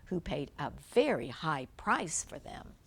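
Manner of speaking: usual speaking voice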